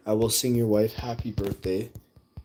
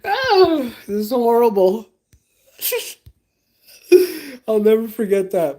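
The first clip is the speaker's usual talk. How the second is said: loud, high laughing fit; high laughter; 13 dB louder than usual. Real, not acted. Streamer recalling the awkward chat, laughing hard and high. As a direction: crack up at the memory.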